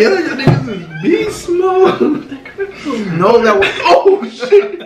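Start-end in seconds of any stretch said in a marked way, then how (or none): 0.0-2.3 laughing, high and slow. Real, not acted. Cracking up at TikTok video. 3.8-4.8 high squeal through laughter; laughing. Real, not acted. Cracking up at video.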